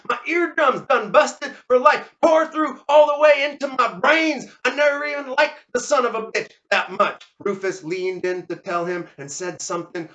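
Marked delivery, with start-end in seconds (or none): 0.1-7.2 high, aggrieved deaf man's holler; hollered complaint. Acted. Deafened Leonard to Rufus, high hollered grievance. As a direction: holler your grievance at him. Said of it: "Leonard hollered at Rufus".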